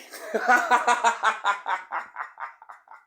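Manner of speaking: long laugh, high and loud; loud gleeful laugh. Real, not acted. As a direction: cackle at the payoff. Laughing at the punchline.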